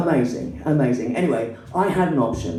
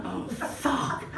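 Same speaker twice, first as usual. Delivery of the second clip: softer, higher mock despair; exasperated groan; 7 semitones higher, 9 dB quieter than usual. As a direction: mutter it in hammed-up exasperation. Her exasperated reaction to the women.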